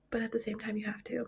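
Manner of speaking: fast unvoiced whisper. Real, not acted. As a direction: whisper it quickly. Drops to whisper.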